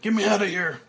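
high, loud frantic shout; man pounding the coffin lid, berserk shout; berserk yell. Acted. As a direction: scream to be let out. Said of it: "Frank goes berserk".

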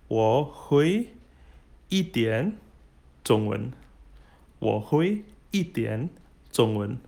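slow, word by word, with gaps; slow model phrase. Shown as a demonstration. Teacher models a Mandarin sentence for beginners, slow and separated. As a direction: space out each word for learners to copy.

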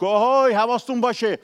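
loud, high hammed-up yell at the enemy; hammed-up angry shout; mock shout. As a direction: bellow it down like a mock Moses. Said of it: "نمیگه موسی از این بالا داد زد".

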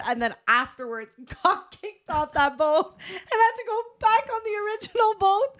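high, squeaky-laugh telling; laughing confession. Real, not acted. Woman admits getting kicked off the boat, laughing as she tells it. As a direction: struggle through the embarrassing part while laughing.

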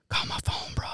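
urgent hushed whisper; hiding in the bathroom, whispering to his roommate. Retold or imitated. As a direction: whisper so she can't hear you. Secretly calling from bathroom.

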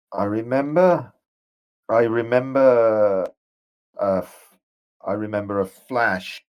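hesitant, haunted memories; a dazed ghost struggling to remember; ghostly general, troubled. Acted. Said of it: "And he says".

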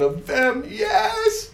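high loud shout of yes; joyful cry. Acted. God saying yes to his people, loud joyful cry. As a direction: cry out in triumph.

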